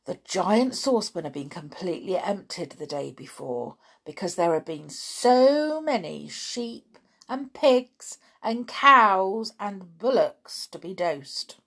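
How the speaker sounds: ordinary delivery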